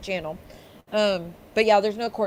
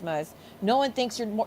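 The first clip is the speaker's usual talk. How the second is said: normal talking voice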